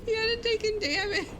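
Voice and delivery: squealing with laughter; high-pitched laughing. Real, not acted. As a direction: talk through giggles, high and breathless. Squealing with laughter at the Minotaur bite.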